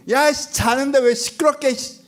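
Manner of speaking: high, irritated comic telling-off; mock scolding. Jesus scolding the noisy sea for waking him, mock irritation. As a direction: grumble sharply at whoever woke you, hammed-up. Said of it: "혼낸 내용이 뭐야?".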